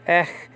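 Ech as one syllable closed by a throaty H; H sound pronounced. Shown as a demonstration. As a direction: end Ech on an audible rough breath, no following vowel. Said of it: "just pronounce the H sound".

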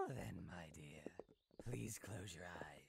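slow low whispered request. Acted. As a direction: whisper it close to the ear. Game voice whispers.

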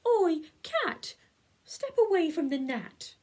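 loud, high frog yell at the cat; frog shouting. Acted. Frog yells at the cat to leave the gnat, loud and high. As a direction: bellow it across the page like a bossy frog.

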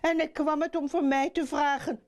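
put-on high, fast young frog; startled exclamation. A narrator's reading. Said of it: "riep de verliefde kikker". (hoge, snelle uitroep van de jonge kikker)

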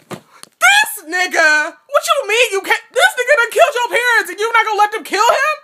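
high, loud, disbelieving yell; outraged shouting. Real, not acted. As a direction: shout at the book in real outrage. Reader outraged at Harry's choice.

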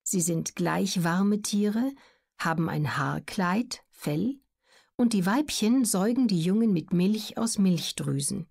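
normal talking voice

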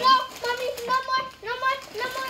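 pleading cry. Real, not acted. Kid pleading from spicy chip.

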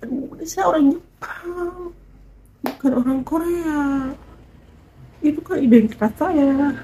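high, soft, put-on voice; hammed-up high protest; mock exasperated Japanese guy. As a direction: play the puzzled foreigner protesting softly. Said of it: "heran dong".

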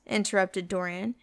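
ordinary delivery, nothing marked

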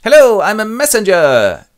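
high, very loud announcement; eager messenger. Acted. Messenger announcing himself, high and very loud. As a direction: proclaim yourself. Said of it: "a messenger enters and goes".

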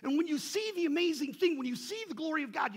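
loud, high, fast exhortation; fervent preaching. Real, not acted. Preacher urging the church about therefore, loud and high. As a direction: drive the point home with force. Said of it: "preacher building to climax".

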